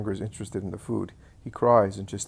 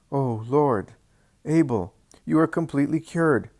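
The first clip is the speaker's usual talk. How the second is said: amazed cry; 5 semitones higher, 6 dB louder than usual. Acted. Friend amazed at healing.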